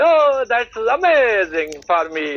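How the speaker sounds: high "Oh", slow and drawn out; excited exclamation. Shown as a demonstration. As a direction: imitate an excited reaction, stretching the words. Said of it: "This is called".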